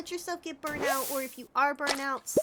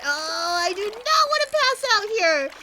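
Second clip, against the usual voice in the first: higher and louder with alarm; anxious rush of words; 7 semitones higher, 10 dB louder than usual. Real, not acted. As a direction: panic a little at the screen. Character nearly fainting.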